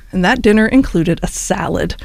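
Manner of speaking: normal talking voice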